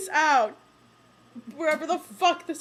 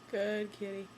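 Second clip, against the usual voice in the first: soft cooing; 7 semitones lower, 10 dB quieter than usual. Real, not acted. Praising the cat softly.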